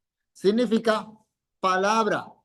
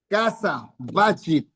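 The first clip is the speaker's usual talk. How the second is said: ordinary talk, nothing marked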